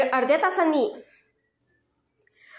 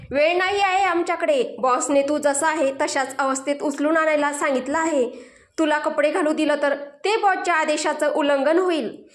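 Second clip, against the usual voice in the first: plain reading voice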